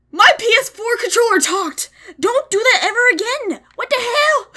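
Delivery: high, loud, startled yelling; startled shout. Real, not acted. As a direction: yell in real shock at the controller. Controller speaker startles him.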